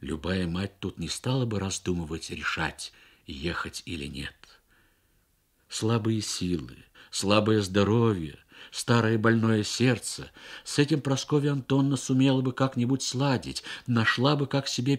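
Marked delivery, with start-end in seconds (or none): none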